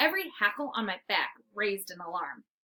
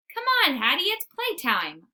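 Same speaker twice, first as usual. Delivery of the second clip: eager bark; 6 semitones higher, 4 dB louder than usual. Acted. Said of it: "I bark".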